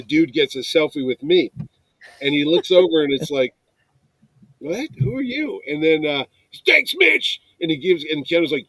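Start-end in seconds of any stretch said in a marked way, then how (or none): 4.6-5.6 baffled. Retold or imitated. Retold reaction to unknown guy. 6.7-7.4 very high, gleeful shout-out; high-pitched mock fanboy; excited fan call. Retold kid calling his thanks.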